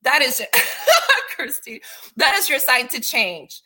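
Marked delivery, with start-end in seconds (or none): 0.5-1.3 a burst of real high-pitched laughter; sudden high laugh. Real, not acted. Laughs at own remark to Christy. 1.3-3.6 words spilling through laughter; laughing while talking. Real, not acted. Teasing Christy while laughing.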